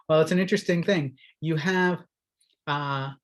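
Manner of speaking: ordinary delivery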